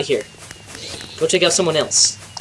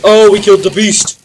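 ordinary talk, nothing marked

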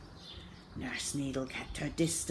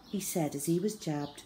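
usual speaking voice

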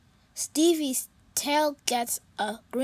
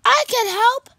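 higher, louder bright cat voice; cheerful kitty voice; 10 semitones higher, 12 dB louder, faster than usual. Acted. Pete greeting his sad friend, high and cheerful.

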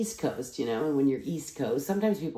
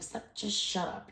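unvoiced, softer hushed version; whispered mock shut-up; whispered retort; 6 semitones higher, 7 dB quieter than usual. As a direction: hiss it under your breath. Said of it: "It's like".

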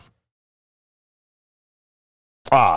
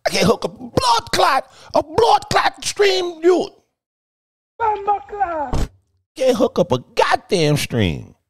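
exasperated yell; 4 dB louder than usual. Real, not acted. Exasperated about stream setup.

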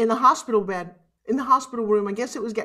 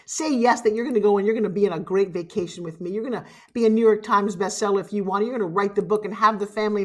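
usual speaking voice

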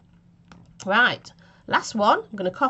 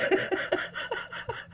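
laughing; 10 semitones higher, 6 dB quieter than usual. Real, not acted. Laughs at own blunder.

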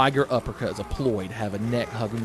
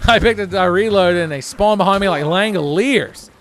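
high, loud complaint; real gamer protest at full voice; exasperated yell; 8 semitones higher, 10 dB louder than usual. Real, not acted. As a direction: yell it in outrage at the game. Enemies spawn behind him, complains.

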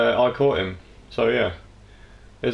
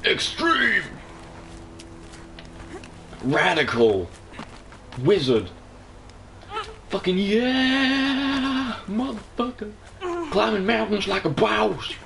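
much higher hammed-up shouts of hype; voicing Aloy's climbing noises; 12 semitones higher, much slower than usual. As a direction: belt out over-the-top climbing cheers for her. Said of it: "she is. She's making those noises".

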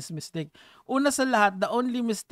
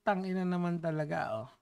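normal talking voice

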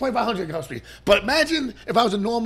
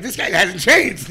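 laughing; 8 dB louder, slower than usual. Retold or imitated. Old classmates laughing at him.

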